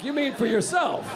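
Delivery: ordinary talk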